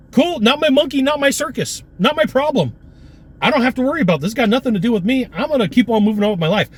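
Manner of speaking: high, carefree shrug it off; breezy dismissal. Retold or imitated. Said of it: "I go".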